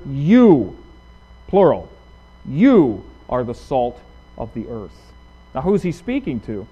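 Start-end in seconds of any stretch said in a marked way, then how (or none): 0.1-5.1 "You" set apart, then "you are the salt" slow; emphatic you. Shown as a demonstration. Preacher showing the emphatic Greek pronoun, stressed. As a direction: lean on the word "You". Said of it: "making an emphatic statement".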